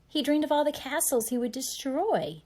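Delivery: plain reading voice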